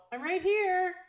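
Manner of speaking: high, loud call-out, rising sharply; mock-offended holler from across the way; retold indignant call. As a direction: call out to be noticed. Retold retort to the groom.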